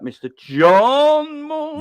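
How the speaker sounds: big drawn-out welcome for the guest; hype announcer. Acted. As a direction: stretch it out like a ring announcer. Hyping the next guest in.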